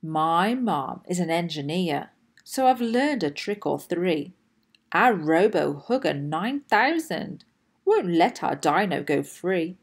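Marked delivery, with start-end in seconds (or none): none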